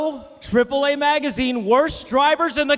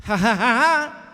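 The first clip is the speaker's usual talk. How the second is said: mock old man laugh; 3 semitones lower than usual. Old Cuban exile laughing.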